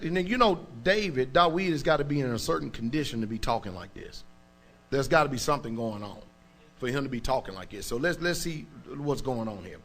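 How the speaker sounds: ordinary delivery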